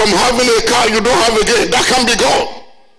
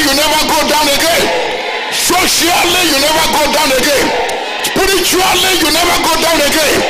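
preaching at full force; 4 semitones higher than usual. Acted. Pastor rallies the congregation, roaring at full force.

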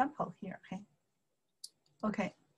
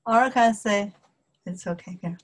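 normal talking voice

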